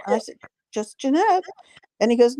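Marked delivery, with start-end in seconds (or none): 0.7-1.4 high, rising little reply; retold modest answer. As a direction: lift it up like a shrugged answer. Retold modest answer to insistent question.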